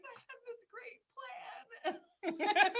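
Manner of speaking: mock lament over a foiled plan, drawn out with laughter; laughing at her own ruined scheme; rueful laughing complaint. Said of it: "but I was like".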